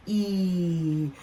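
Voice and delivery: "E" drawn out slowly as "ee"; long E sound. Shown as a demonstration. Teacher repeating the second E A sound, long ee. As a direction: hold "E" long and steady. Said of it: "It gives".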